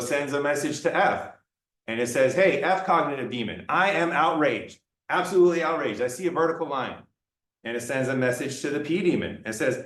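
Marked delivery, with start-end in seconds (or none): none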